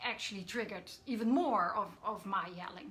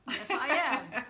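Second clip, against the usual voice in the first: amused agreement; 2 semitones higher, 8 dB louder than usual. Real, not acted. Guest agreeing with co-host's "Yes, you are", laughing. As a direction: agree brightly through a real laugh.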